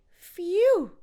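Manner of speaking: loud high sigh of relief; relieved phew. Shown as a demonstration. Passport found, a loud high phew of relief. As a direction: let the relief blow out of you.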